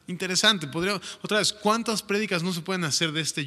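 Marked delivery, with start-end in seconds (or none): none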